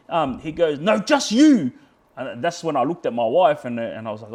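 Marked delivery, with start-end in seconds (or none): 0.8-1.7 imitating the cop's louder, higher yelled reply; mock police shout, sharply raised; retold yell. Cop answering which person he means, imitated yell. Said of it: "he just kept yelling at me".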